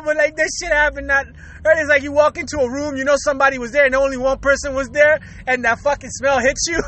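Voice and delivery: ordinary talk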